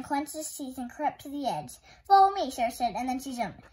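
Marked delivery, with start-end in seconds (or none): 2.1-2.5 loud call. A narrator's reading. Sarah leaps calling out.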